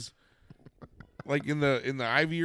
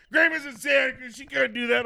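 high, raised voice in protest; mock indignant shout; 9 semitones higher, 4 dB louder than usual. Kids object to Grandma being blamed, mock indignant shouting. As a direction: imitate an outraged objection to a silly claim.

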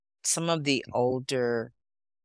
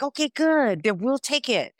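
high, louder imitated deal-closing; acted brisk acceptance; 12 semitones higher, 4 dB louder than usual. Recruiter taking her delight as consent, high acted satisfaction. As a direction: seize on the yes in a raised voice. Acts out recruiter seizing on yes.

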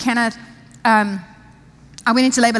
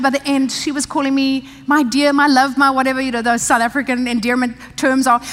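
ordinary talk

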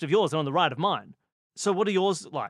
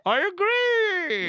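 high, slow, hollow tube voice; drawn out down a pipe; through a tube; 13 semitones higher, 3 dB louder, much slower than usual. Pretend. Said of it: "This is my through a tube voice".

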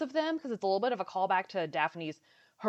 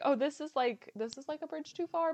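appalled; 9 semitones higher than usual. Retold or imitated. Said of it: "I was like".

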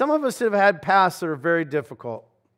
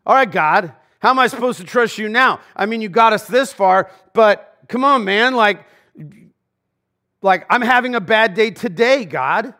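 mocking complaint to God, louder, higher and quick; whiny grumbler; 5 semitones higher, 5 dB louder than usual. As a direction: act out a petulant, entitled complaint. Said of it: "The attitude of".

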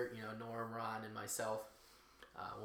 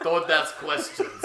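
put-on Russian accent; 2 semitones higher, 8 dB louder, slower than usual. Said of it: "he has a Russian accent".

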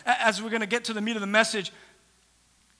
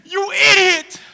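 acted high, loud, tearful yell; exploding in grief-stricken anger; enraged insult; 7 semitones higher, 10 dB louder, slower than usual. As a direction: hurl the insult in anguished fury. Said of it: "No, no, she was like".

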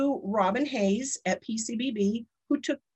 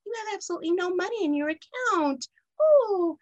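sweet little voice; 9 semitones higher than usual. Retold or imitated. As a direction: chirp it sweetly like a tiny kind bank teller. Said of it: "she talked like this".

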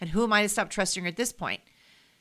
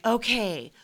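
grand, theatrical invocation, a bit higher and louder; mock dramatic; 4 semitones higher, 3 dB louder than usual. Retelling her own overdramatic call to the Akashic Records. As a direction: summon it like a solemn ritual, hammed up. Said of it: "I was very dramatic about it".

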